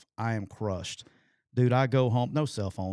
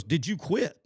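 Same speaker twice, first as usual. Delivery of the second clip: a higher, sharper coach's repeat of "Did you quit?"; 6 semitones higher than usual. Retold or imitated. Coach repeating his question to the player, sharper and higher. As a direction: press him again like an impatient coach. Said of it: "He goes".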